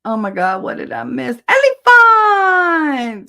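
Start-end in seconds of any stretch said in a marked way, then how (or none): 1.5-3.3 high, loud, long-drawn greeting; calling out. Real, not acted. Host greeting a viewer just noticed, loud drawn-out call. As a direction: holler the name, stretching the end.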